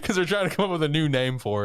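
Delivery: amused laugh through the words. Real, not acted. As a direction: explain it while cracking up. Laughing at a funny scene.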